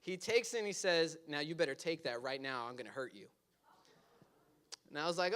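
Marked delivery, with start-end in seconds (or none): 1.3-3.3 low, soft retold menace; quiet threat. Drunk uncle forcing a shot on a teenager, quietly menacing. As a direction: drop down low for a mock threat.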